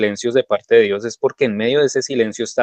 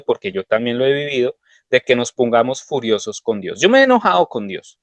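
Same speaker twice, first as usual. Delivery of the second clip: ordinary delivery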